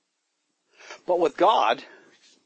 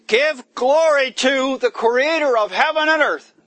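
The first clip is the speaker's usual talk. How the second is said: angel's proclamation; 10 semitones higher, 7 dB louder than usual. Acted. Angel's loud message read.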